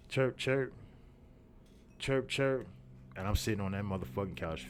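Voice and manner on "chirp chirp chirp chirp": high, short double chirps; imitated phone chirp. Storyteller chirping her on the phone, high mimicked chirps.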